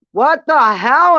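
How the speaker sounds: high, loud and drawn out; stunned what the hell; shocked. Retold or imitated. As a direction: caught completely off guard, slowly. Sister shocked at elevator door.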